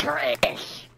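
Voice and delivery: Danny DeVito voice. Pretend. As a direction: growl the word like a gruff little guy. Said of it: "That's my Danny DeVito voice".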